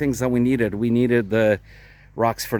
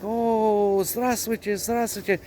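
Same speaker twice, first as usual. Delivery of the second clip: higher-pitched mock enthusiasm; imitated delighted greeting; 7 semitones higher, slower than usual. Imitating the driver shaking his hand, delighted greeting. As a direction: imitate a thrilled, repeated hello.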